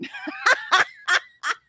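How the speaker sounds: loud, very high peals of laughter; squealing laugh. Real, not acted. Host reading an absurd translation, squealing laugh. Reacting to the chicken message.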